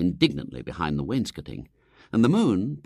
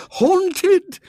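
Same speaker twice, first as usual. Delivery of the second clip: much higher, much louder scream; screamed in chorus; 10 dB louder than usual. Acted. Society ladies screaming at the news, shrill chorus. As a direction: shriek it all together in alarm. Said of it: "they screamed in chorus".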